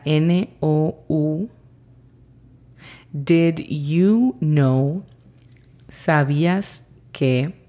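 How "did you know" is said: very slow careful English; teacher demo, very slow; slow model phrase. Shown as a demonstration. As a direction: drag each word for learners. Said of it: "La pronunciación fonética de esta sería".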